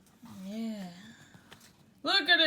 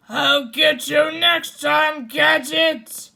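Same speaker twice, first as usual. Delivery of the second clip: loud menacing villain vow; villain's threat; 3 semitones higher, 7 dB louder than usual. Acted. Doctor Claw vowing revenge on Gadget, loud and menacing. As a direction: swear revenge like a cartoon villain.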